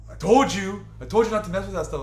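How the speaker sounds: loud, fast scolding I told you. Retold or imitated. Julio scolding his friend, loud and fast told-you-so.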